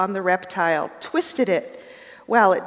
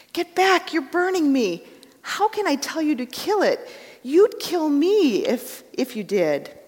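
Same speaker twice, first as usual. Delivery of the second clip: frightened ghost; 7 semitones higher than usual. Acted. Ghost shrinks from burning angel.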